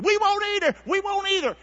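high, eager chorus of promises; protesting disciples. Acted. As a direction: chime in high, one after another. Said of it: "every one of his disciples said".

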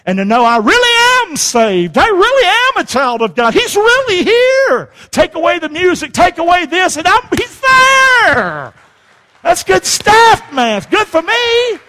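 soaring revival shout; full-force preaching. Real, not acted. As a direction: cry it out to the congregation, stretching the last word.

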